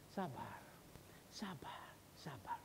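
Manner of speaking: very soft, spaced-out urging; calm someone down, almost under the breath; low, hushed plea for patience. Retold or imitated. God's voice urging patience, retold.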